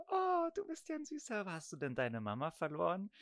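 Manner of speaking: put-on high, sugary voice for a little boy; sweet doctor voice. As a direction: coo it like a doting doctor. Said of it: "und sie so".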